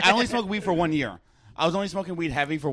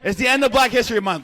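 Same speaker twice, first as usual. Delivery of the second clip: loud high yell; shouting; 7 semitones higher, 9 dB louder than usual. Real, not acted. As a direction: call it out at full volume. Yelling a jokey retort.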